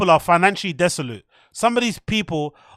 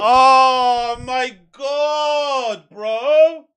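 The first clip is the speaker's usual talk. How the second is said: stunned shout; 3 semitones higher, much slower than usual. Real, not acted. Shocked reaction to the clip.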